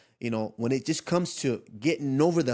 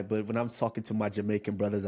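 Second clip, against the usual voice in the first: ordinary talk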